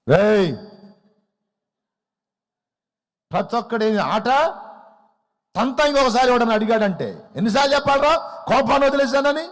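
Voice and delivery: the patient man finally snaps; acted fit of temper; furious outburst. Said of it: "అని కోపంతో చెప్పాడు".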